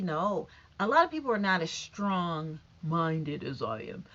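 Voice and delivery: ordinary talk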